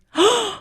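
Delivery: sharp mock gasp; high startled intake of breath; imitated gasp. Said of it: "she was going".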